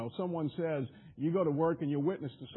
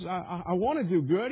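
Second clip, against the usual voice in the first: louder, stammering earnest plea; Paul's voiced frustration, louder; voicing frustrated Paul; 6 dB louder than usual. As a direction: push out the stammer like a frustrated man. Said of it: "He says".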